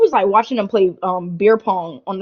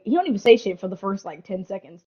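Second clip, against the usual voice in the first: normal talking voice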